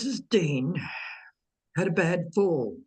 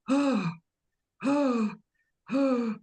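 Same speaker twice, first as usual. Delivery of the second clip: distraught; 7 semitones higher than usual. Retold or imitated. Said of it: "really distraught".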